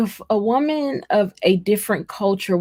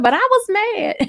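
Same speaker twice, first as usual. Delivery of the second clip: very high, sheepish admission; church mother; 12 semitones higher than usual. Retold or imitated. An older church lady owns up to being mad, very high and sheepish. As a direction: confess it high and a little embarrassed. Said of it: "She said".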